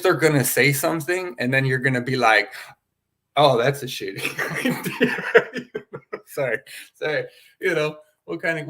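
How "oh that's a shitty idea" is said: higher, slow, breaking into laughter; laughing mock dismissal. Mimicking an adult judging a kid's answer, laughing mock dismissal. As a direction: crack up while mimicking a dismissive grown-up.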